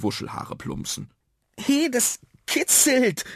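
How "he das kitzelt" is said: put-on high, stretched-out child's cry; slow, high-pitched exclamation; calling out, tickled. A narrator's reading. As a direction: squeal it in protest at a tickle. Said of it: "rief Nuno".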